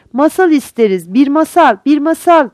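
put-on clamor for a story like eager kids; children shouting. A narrator's reading. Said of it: "diye bağırdılar". (çocukça bağırarak)

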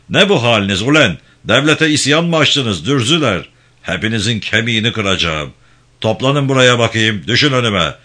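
loud, angry harangue; tyrannical gendarme chief. Acted. Gendarme chief rounds up villagers, angrily haranguing them.

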